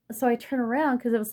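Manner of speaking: ordinary delivery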